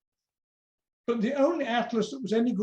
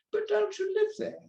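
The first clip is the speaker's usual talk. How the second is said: high-pitched schoolmistress voice; teacher's questioning voice; 13 semitones higher than usual. A narrator's reading. New teacher from near London asks Tom, high questioning voice. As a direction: ask it high, as a puzzled teacher.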